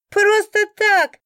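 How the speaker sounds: high, loud, slow mocking echo of просто так; mocking laugh. A narrator's reading. A bystander mocking the drunk's excuse, loud laughing echo. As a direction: throw the words back with a guffaw. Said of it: "хохотнул кто-то".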